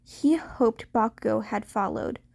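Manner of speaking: ordinary delivery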